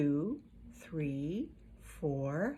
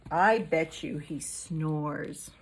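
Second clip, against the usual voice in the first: usual speaking voice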